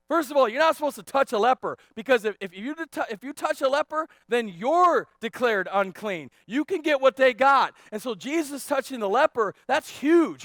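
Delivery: loud, high emphatic preaching; full-force preaching. Real, not acted. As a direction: hammer out why this matters.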